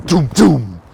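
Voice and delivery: imitating two loud drum booms. Imitating the giant drum, loud mock booms. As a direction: boom it out like a huge drum. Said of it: "And then they go".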